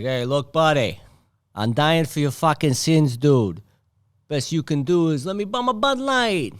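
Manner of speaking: mock Boston accent Jesus. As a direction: play Jesus as a Boston bro. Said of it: "Jesus sound like if he had a Boston accent".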